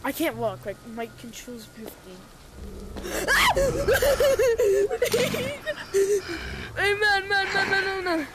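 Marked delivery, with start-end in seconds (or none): 2.2-6.7 a loud, high shriek of laughter; panicked laughing shriek at the game; laughing scream. Real, not acted. Laughing scream during a scare. 6.8-8.3 high, loud repeated calling; rapid panicked pleading; frantic player shouting at the screen. Real, not acted.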